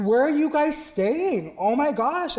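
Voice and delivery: high, eager questioning; excited concern. Retold or imitated. Teacher asks the students where they are staying, high and eager. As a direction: fuss over them with delight. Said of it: "And I'm like".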